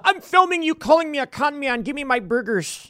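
high-pitched mock confrontation; mock filming rant. Imagined rant at the McDonald's worker, mock indignant. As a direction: play the indignant customer filming staff.

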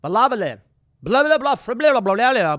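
loud, high mock foreign-language shout; shouting back gibberish. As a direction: shout back incomprehensible babble. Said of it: "The guy shouts back".

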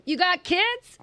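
high-pitched probing question; imitated nosy comic. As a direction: mimic the question, rising high. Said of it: "and they go".

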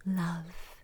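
hushed ASMR meditation voice; whispered meditation. Acted. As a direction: whisper them gently into calm.

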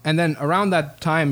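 ordinary delivery

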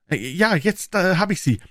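Wilson's voice. A narrator's reading. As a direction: pitch him up as the earnest tradesman.

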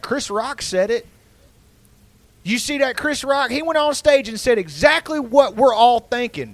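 high, excited uncle voice; a hint of a smug Southern uncle. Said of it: "He's like".

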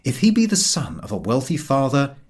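ordinary delivery

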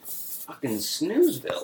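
slow, drawn-out bored verdict; mock boredom. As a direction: stretch it out as if nodding off. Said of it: "I was just like".

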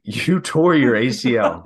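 slow, loud, chuckling repeat of the question; mock them through a laugh, drawn out; chuckling mockery. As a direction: people snickering at the injured diner, chuckling loudly. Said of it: "people start, like, chuckling".

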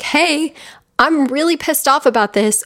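a hint of an angry little kid; inner child voice. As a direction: voice the hurt child inside. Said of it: "that little kid you that's just like".

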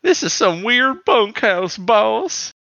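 hammed-up grizzled cowboy drawl; old prospector. Voicing cowboy Quick Strike.

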